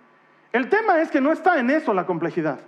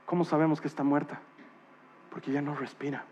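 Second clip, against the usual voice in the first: much softer and lower; hushed and grave; 6 semitones lower, 8 dB quieter, faster than usual. Real, not acted. Preacher to the congregation on dying, hushed and solemn. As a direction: speak of death barely above a breath. Hushed talk about death.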